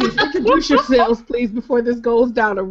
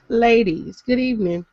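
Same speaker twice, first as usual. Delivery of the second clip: ordinary talk, nothing marked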